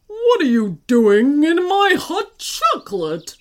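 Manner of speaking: loud booming giant bellow. A narrator's reading. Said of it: "bellowed the giant loudly".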